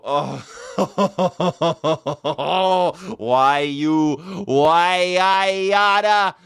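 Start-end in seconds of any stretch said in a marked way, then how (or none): 0.5-3.1 real laughing fit; burst of laughter. Real, not acted.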